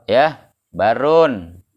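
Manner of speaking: "barun" said slowly with an open a, ba-run; barun with a fathah. Shown as a demonstration. Teacher slowly reading the fathah form to students. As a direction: draw out "barun", a on the ba. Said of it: "Kalau ente baca ba-nya fathah".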